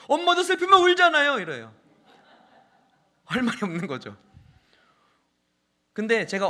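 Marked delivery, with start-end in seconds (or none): 0.1-1.4 put-on loud, high-pitched child's retort; imitated child talking back. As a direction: answer back to mom at full volume. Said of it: "정확한 논리로 받아칩니다". (엄마에게 큰 소리로 받아치는 아이 흉내)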